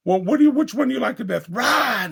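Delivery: high, loud and fast imitated outburst; impatient call. Girlfriend losing patience, calling him.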